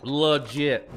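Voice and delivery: "Legit" in Appalachian twang, vowel of "le" reduced to "luh-JIT"; Appalachian drawl on "Legit". Shown as a demonstration. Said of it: "That's the Appalachian way to say legit".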